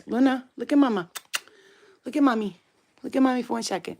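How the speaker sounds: doting mommy voice; coaxing pet talk. Real, not acted. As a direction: coax a puppy to look at you. Coaxing the dog to look.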